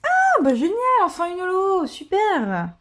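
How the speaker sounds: real high-pitched jubilation; overjoyed. Real, not acted. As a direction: burst out in joy at a long-awaited win. Finally pulls a holo card.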